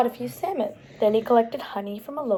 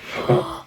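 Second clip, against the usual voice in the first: gasp; 5 semitones higher than usual. Pretend. Surprise reveal in storybook.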